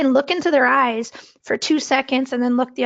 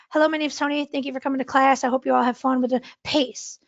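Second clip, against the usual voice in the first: rushed, run-together introduction; nervous fast talking. Shown as a demonstration. Teacher demonstrating nervous pace to class, talking really fast. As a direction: rattle it off really fast, no pauses. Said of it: "I'm talking really fast".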